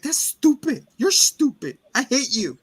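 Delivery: put-on high, loud petulant child; bratty kid. Boy to his babysitter, high bratty whining. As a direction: spit it out like a sulking boy.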